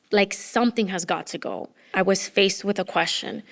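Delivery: normal talking voice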